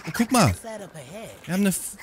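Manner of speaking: ordinary delivery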